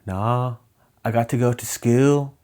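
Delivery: indignant British schoolgirl, imitated; regional British accent. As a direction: mimic a little girl's regional British accent. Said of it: "that accent is actually really fun".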